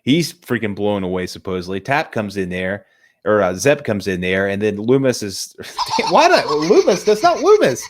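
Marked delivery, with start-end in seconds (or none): 5.8-7.8 high, loud, cracking up at a slip; real laughing disbelief, pitched way up. Real, not acted. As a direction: squeal through laughter at your own mistake. Cracks up at own name slip.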